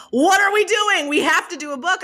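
high-pitched, excited urging; eager editor. Retold or imitated. Editor urging an author to do a book, excitedly. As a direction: push the project with an eager, lifted voice.

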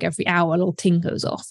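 usual speaking voice

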